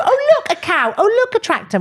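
put-on high, sing-song voice for a toddler; sing-song parent voice. Mum pointing out farm animals to small children, high and sing-song. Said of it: "parents of very small children will go".

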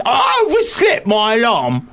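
loud, high, drawn-out Cockney shout; hammed-up Cockney outrage. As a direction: bellow it as an aggrieved Cockney. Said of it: "Can we do your Cockney?".

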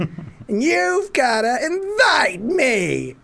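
very high, slow, strained stage shout. Retold or imitated. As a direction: wail it up like a needy frontman. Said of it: "He's like going".

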